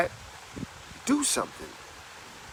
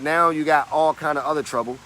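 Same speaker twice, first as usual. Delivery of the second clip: ordinary talk, nothing marked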